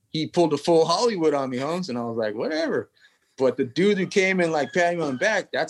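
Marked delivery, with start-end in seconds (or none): 2.3-2.9 whatever, thrown up high and dropped; brushing off the actor's snub, high then falling; dismissive brush-off. Retold or imitated. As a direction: shrug the snub off. Brushing off Rob Schneider.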